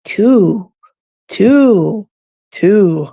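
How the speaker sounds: lightly voiced toddler saying TWO; drawn-out TWO, mimicking a little kid; imitating a two-year-old. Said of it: "When he says, Let him say".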